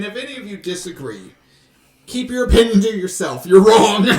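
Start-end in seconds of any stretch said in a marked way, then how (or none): 2.1-4.1 loud, higher playful outburst; mock angry shout. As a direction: bark it at the audience. Mock threat to disagreeing listeners.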